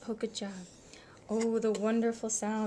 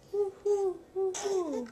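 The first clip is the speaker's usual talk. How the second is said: high, loud hooting owl; 8 semitones higher, 5 dB louder, slower than usual. Acted. Parent reading animal book, loud high owl hoot.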